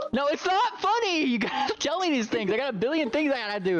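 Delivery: high-pitched mock-indignant protest; laughing exasperation. Real, not acted. Game master scolding player over goggles, exasperated protest.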